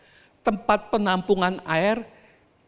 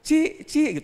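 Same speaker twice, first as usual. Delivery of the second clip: calling out; 8 semitones higher than usual. Retold or imitated. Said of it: "Terus dia panggil".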